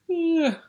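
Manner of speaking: loud, high stretching yawn; sleepy rabbit's long yawn; big yawn. Acted. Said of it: "yawned Rabbit".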